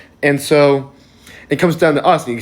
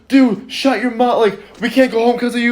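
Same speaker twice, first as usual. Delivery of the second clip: much higher-pitched mock scolding; retold trash talk; 10 semitones higher than usual. Lady talks trash to the guy, mock scolding. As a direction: imitate a fed-up passenger telling someone off. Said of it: "is talking trash to the guy".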